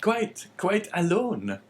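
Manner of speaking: high, slow innkeeper voice; drawn out by the host, pitched high. A narrator's reading. Innkeeper voice in story.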